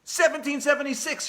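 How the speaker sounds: high, loud blurted answer; shouted answer. A narrator's reading. Quiz rival shouting her answer, high and loud. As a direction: shout it out fast to win the point. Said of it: "shouted Morgan Brocklebank".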